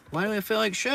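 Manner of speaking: high, loud whiny put-on voice; mock whiny question. Himself mocking his own complaint in a high whine. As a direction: whine it like a self-pitying kid.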